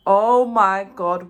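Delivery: a stretched-out shocked gasp of words; shocked exclamation. Retold or imitated. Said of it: "And I'm like".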